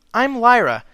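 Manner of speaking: high, bubbly and eager; a perky pony gushing with curiosity; excited high mare voice. A narrator's reading. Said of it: "the minty mare asked".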